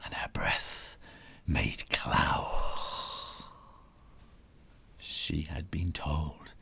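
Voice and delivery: soft unvoiced whisper. A narrator's reading. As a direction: breathe the words out. Quiet cold room.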